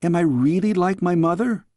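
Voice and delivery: a worried little princess; young girl. Acted. Girl asks her nanny.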